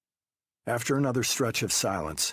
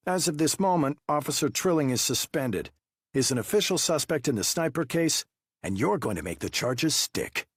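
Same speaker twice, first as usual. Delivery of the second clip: high, pressing investigator voice; interrogating detective; 7 semitones higher than usual. Acted. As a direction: push him with pointed questions. Wu announcing suspension.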